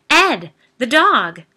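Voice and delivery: loud high yell for help. Acted. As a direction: yell across the house urgently. Woman yelling for husband.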